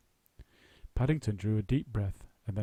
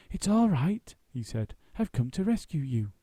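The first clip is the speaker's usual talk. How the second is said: Paddington voice; 5 semitones higher, 4 dB louder than usual. A narrator's reading.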